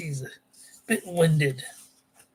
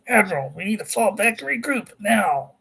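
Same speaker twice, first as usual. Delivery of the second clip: urgent raised call over the radio; 5 semitones higher, 4 dB louder than usual. Acted. Delenn calls out over the radio.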